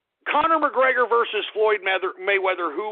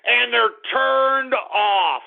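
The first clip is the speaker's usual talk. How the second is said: slow emphatic declaration; 5 semitones higher, much slower than usual. Acted. Mocking women's reaction.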